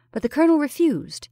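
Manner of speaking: usual speaking voice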